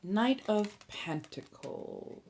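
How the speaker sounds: ordinary delivery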